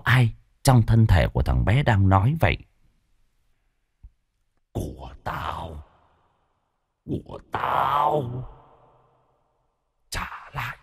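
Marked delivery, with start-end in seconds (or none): 4.7-10.8 slow, soft, eerie menacing rasp; a spirit speaking through the boy, threatening; possessed child's voice. A narrator's reading. Possessed boy demands something back, slow ghostly threat. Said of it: "trở nên ồm ồm".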